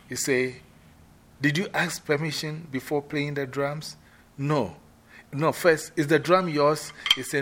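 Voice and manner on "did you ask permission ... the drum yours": high, loud interrogation; stern questioning. Shown as a demonstration. Queen Mother rehearsing, loudly interrogating the player. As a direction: press him for the truth.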